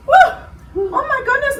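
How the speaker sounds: high whoop after a pretend sprint; exhilarated. Host finishing the pretend run with children, high exhilarated whoop.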